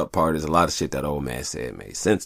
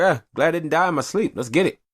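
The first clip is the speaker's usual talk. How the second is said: upbeat self-quote; 11 semitones higher, 4 dB louder than usual. Retold or imitated. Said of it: "I'll be like".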